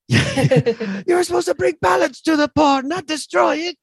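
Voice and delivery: high, loud mock Obi-Wan rant. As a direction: wail it like a betrayed mentor. Obi-Wan quote parody, high voice.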